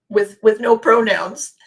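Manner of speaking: tickled, about to crack up; amused. Real, not acted. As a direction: laugh bubbling up through the words. Breaking into laughter at his terseness.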